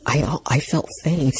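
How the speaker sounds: plain reading voice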